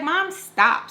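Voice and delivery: protesting. Retold or imitated. Daughter telling her nagging mom to quit, protesting. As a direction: push it up at mom.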